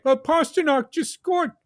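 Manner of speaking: put-on high, whiny little-kid voice; slow, high-pitched sheepish kid. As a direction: explain yourself like a scolded child. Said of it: "like a little kid".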